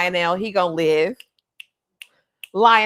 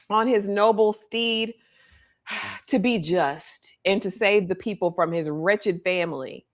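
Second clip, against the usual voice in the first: ordinary talk, nothing marked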